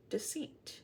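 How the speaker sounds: breathy dramatic whisper. Acted. Tarot reader whispering to viewers. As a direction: whisper it like a secret.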